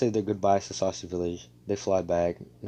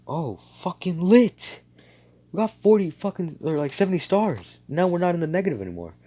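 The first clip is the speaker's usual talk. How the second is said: much higher, louder, giddy cheering; hyped celebration; 9 semitones higher, 6 dB louder than usual. Retold or imitated. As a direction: cheer like you just won big. Squad celebrates getting stars.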